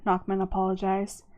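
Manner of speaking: usual speaking voice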